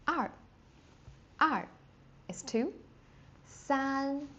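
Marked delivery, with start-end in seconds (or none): 3.5-4.3 San held high and level; first tone san. Shown as a demonstration. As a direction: hold San high, never dropping. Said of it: "first tone again, never drops".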